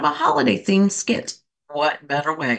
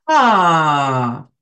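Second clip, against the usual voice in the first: big audible sigh, louder; 3 semitones lower, 4 dB louder than usual. Shown as a demonstration. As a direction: release it all in one long loud sigh. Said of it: "and sigh it out".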